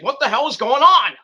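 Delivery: loud, high hammed-up demand; shouting to break up the fight; mock yell. Michael Cole breaking up the fight with a loud mock yell. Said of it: "He's like".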